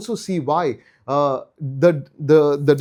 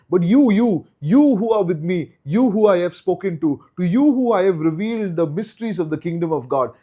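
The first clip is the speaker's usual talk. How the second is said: each "you" punched louder and higher, building the plea; emphatic stress on you; 4 semitones higher, 4 dB louder than usual. Shown as a demonstration. Teacher voicing Jesus pressing his disciples, stressing "you". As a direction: lean hard on every "you" as if pointing at each disciple. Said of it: "The emphasis on the you".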